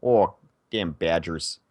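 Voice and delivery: hammed-up Wisconsin sports fan accent; Minnesota-Wisconsin accent. Shown as a demonstration. Badgers fan grumbling, mock Upper Midwest accent. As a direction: round Upper Midwest O's. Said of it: "so I don't really have the".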